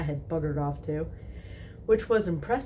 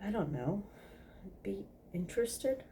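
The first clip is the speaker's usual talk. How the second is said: shy trailing off; 4 semitones higher, 8 dB quieter than usual. Acted.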